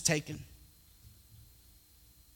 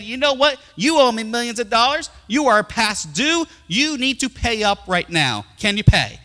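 loud, raised voice of an acted creditor king; demanding king; 7 semitones higher, 7 dB louder than usual. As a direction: demand payment like an impatient ruler. King demands payment from debtor.

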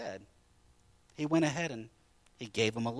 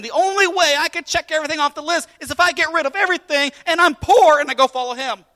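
higher, louder, fast protest; indignant objection; 13 semitones higher, 13 dB louder than usual. Acted. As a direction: blurt out the unfair demand in disbelief. Voicing the rich man's objection.

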